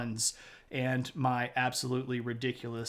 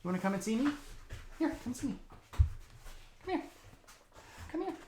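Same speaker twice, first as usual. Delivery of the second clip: high, light calling voice; coaxing a puppy; 9 semitones higher, 5 dB quieter than usual. Real, not acted. Owner calling his puppy to come, high coaxing voice. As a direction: coax a pet over, pitched high.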